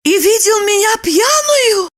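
high-pitched worried woman; anxious Lipochka. A narrator's reading. Woman asks if husband saw her drunk, high anxious voice. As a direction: ask it high, in dismay.

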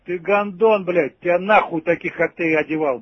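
ordinary talk

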